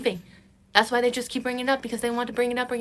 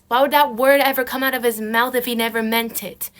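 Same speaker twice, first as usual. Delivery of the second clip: usual speaking voice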